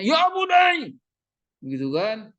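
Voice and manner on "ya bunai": high, loud shout, stretched out; harsh wrong reading. Shown as a demonstration. Teacher mocks a wrong reading of fatherly advice, shouted high. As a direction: yell it like a stern command. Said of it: "Kan nggak mungkin dia bacanya".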